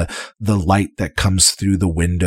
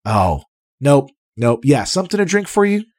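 much higher, eager host voice; jolly innkeeper; 9 semitones higher than usual. Acted. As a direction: fuss over the guests happily. Otik takes the order.

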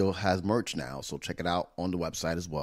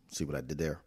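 ordinary talk

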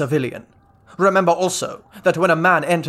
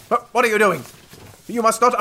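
higher, stammering shock; 6 semitones higher than usual. Acted. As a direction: blurt it out, scandalised. General shocked at undressing.

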